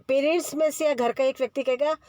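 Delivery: normal talking voice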